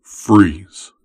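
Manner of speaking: a sudden loud barked command; commanding freeze. Acted. Wizard freezing the summoned listener, loud command. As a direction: stop the listener dead with one word. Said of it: "you hear someone's voice say".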